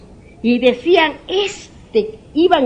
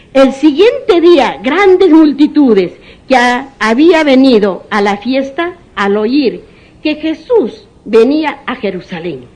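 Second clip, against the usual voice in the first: slow, louder pulpit reading; proclaiming scripture; 3 semitones higher, 7 dB louder, slower than usual. Acted. Preacher reading the Gospel to brothers, slow and loud. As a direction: read it out to the whole church, slowly.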